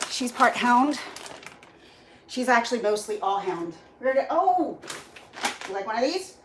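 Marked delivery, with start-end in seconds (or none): none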